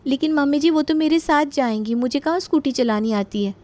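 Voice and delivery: a hint of a young wife explaining herself; daughter-in-law pleading. Daughter-in-law answers mother-in-law in story.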